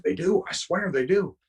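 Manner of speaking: worked-up insistence. Retold or imitated. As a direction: imitate someone insisting, voice pushed up high. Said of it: "get all worked up".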